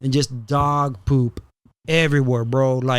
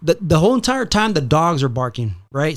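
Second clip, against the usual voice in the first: ordinary talk, nothing marked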